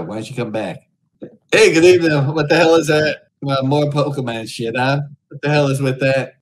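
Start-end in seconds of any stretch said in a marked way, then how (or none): 1.5-6.3 loud, high leering lounge-lizard greeting; sleazy greeter. Acted. Streamer greets Omegle strangers as a leering creep, loud and high. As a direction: belt it out like a creepy host hitting on strangers.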